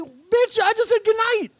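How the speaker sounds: high, loud mock outrage; retold exasperation. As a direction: fire it back in exaggerated disbelief. Retells exasperated reply to friend.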